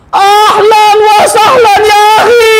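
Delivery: high, slow joyful greeting; joyful welcome. Acted. Lender welcoming the debtor home, high and slow. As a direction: draw the welcome out, high and delighted.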